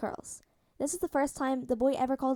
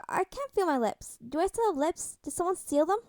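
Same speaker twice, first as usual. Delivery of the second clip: put-on dopey drugged boy; 6 semitones higher than usual. Numb drugged boy worried.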